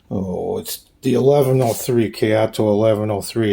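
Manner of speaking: normal talking voice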